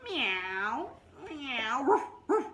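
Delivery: playful meows then high loud woofs; animal noises. Acted. Teacher imitates cats and dogs woken by crying. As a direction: meow then bark like the neighborhood pets.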